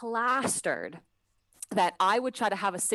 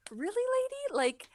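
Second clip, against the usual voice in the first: mock incredulous; 12 semitones higher than usual. Crew member silently baffled by passenger's elevator question, mock incredulous. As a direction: pitch it high, hammed-up disbelief.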